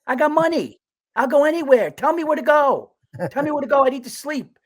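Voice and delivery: high, loud, worked-up complaining; exasperated rant. Acted. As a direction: shout it in comic desperation. Ranting about having no hotel bed.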